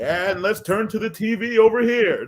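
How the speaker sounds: game show host announcing; lightly voiced TV host pitch; Price is Right voice. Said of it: "I usually have to do the Price is Right voice".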